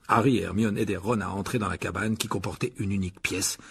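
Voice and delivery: ordinary delivery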